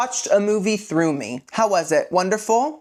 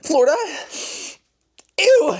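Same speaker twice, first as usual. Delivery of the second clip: disgusted Bella; 9 semitones higher than usual. Retold or imitated. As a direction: scoff at Florida like a spoiled teen. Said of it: "Bella's like".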